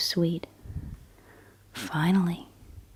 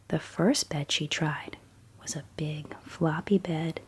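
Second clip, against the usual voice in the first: whispered bedtime story. A narrator's reading. Storyteller reading Goldilocks at bedtime, in a hushed whisper. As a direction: whisper the tale as if a child is drifting off.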